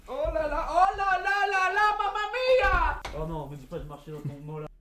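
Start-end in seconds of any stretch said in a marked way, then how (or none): 0.1-3.0 high, slowed mamma mia; hammed-up Italian exclamation. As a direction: stretch it like a cartoon Italian. Exclamation exagérée à l'italienne. (exclamation italienne exagérée)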